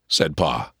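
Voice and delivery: usual speaking voice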